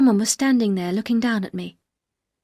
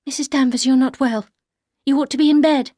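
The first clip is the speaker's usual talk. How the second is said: higher and louder, firm; wife telling the housekeeper to rest, firm; firm concern; 5 semitones higher, 7 dB louder than usual. Acted. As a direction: order her to rest. Heroine orders her.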